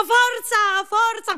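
shrill-free high cheering push; high strained cheer. Acted.

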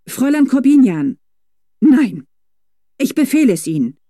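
high, loud command; commanding shout. A narrator's reading. Felicitas ordering her governess to pay, loud command. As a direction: overrule the governess.

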